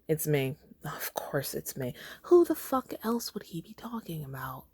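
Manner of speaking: hushed, breathy disbelief, half to herself; shocked whisper. Real, not acted. Reader muttering her shock at the story, whispered. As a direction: whisper it like you can't believe it. Shocked aside while reading along.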